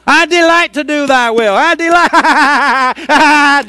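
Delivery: loud, high holler, last word hugely stretched; screamed declaration. Retold or imitated. Preacher repeats the cry that turned things around, screamed declaration. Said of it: "I started screaming it".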